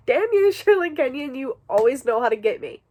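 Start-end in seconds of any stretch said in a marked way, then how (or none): 0.1-1.3 higher, playful shake of the fist; mock curse. Reader scolding Sherlyn Kenyon for her tears, mock outrage. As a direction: pretend to damn her, laughing.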